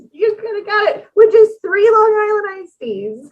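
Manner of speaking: high, loud teasing exclamation; excited. Real, not acted. Teasing about cheap drinks.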